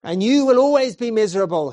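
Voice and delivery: loud, quick, high warning; preacher warning stranger from pulpit, loud. Acted. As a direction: warn the young man loudly. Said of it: "he continued".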